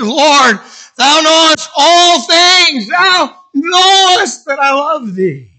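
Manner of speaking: high, loud, drawn-out declaration; impassioned cry. Acted. Peter professing love to the Lord a third time, impassioned cry. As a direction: cry it out slowly at full voice.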